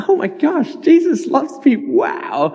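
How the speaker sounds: thrilled squeal of first faith; giddy amazement. Retold or imitated. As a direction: gush like a brand-new believer. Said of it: "everything was just like".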